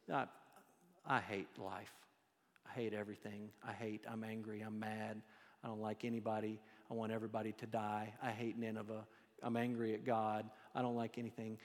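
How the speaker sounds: low, quiet, sulky moaning; sulky whine.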